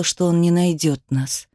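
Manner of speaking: usual speaking voice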